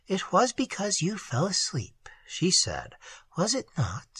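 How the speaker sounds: put-on whispered, unhurried girl's voice; hushed Isande. A narrator's reading. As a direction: breathe it to him, teasing gently.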